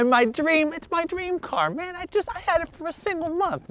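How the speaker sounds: high and pained; mock-heartbroken owner mourning his car. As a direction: whine it like it hurts.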